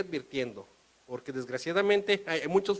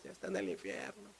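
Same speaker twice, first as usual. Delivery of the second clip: hushed grave aside; 9 semitones lower, 11 dB quieter than usual. Real, not acted. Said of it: "low grave aside".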